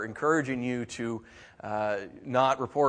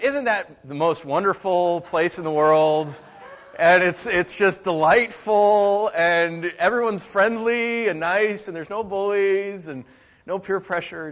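sarcastic gushing; 5 semitones higher, 8 dB louder, slower than usual. Acted. Sarcastic praise of school bus.

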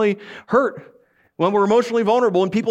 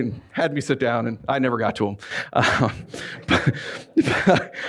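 normal talking voice

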